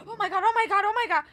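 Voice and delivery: mock the coworkers' shrieking panic, laughing through it; imitating freaking out. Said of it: "They were freaking out".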